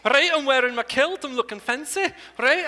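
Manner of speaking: showing off a Scottish accent. Shown as a demonstration. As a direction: brag about the kilt in a Scottish accent. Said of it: "worked on the Scottish accent".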